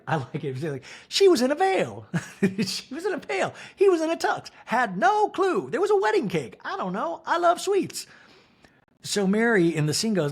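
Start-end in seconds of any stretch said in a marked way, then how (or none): 1.1-8.1 high mother voice. Retold or imitated. Mary's confession voiced high.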